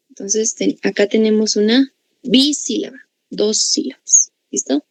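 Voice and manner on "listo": usual speaking voice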